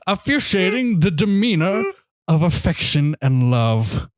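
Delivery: pompous critic, slowing grandly to the close; snobby critic. Acted. Podcaster ending a scathing movie review, snobby critic voice. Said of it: "get into my snobby critic voice".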